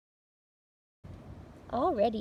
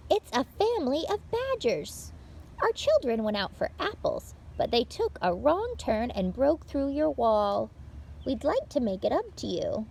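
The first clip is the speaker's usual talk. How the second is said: bright, lively read-aloud for little listeners; picture-book reading; 4 semitones higher, 9 dB louder than usual. Acted. Librarian reading a picture book to children, lively read-aloud. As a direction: tell it like story time for small children.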